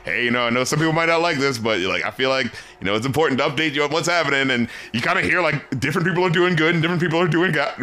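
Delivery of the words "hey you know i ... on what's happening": casual update voice. Retold or imitated. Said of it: "like, the".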